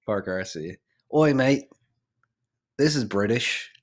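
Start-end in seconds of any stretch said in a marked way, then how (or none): 1.1-3.7 a hint of a cockney "Oi, mate"; mock British accent. S1 voicing Peter teasing his own accent, lightly British. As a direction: lightly send up a Brit mocking the accent. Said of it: "Making fun of my accent".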